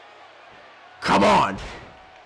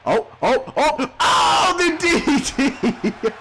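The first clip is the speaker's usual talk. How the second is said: rising excited cries, much higher; excited shout; 10 semitones higher, slower than usual. Real, not acted. Gamer hyping a DDT on Kane, excited shout. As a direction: hype up the big DDT.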